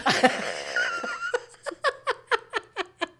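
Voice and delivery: high, bursting laugh at herself; a real, high laugh of self-mockery; big laugh. Real, not acted. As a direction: laugh out loud at the irony. Laughs at own confession.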